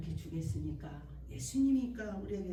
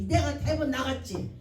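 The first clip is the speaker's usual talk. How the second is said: normal talking voice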